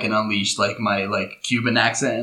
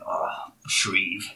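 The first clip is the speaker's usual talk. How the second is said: hushed, half-whispered mock resentment; mock envious grumble; 4 semitones lower, 8 dB quieter than usual. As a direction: grumble it low and soft under your breath. Retells his envious private grumble.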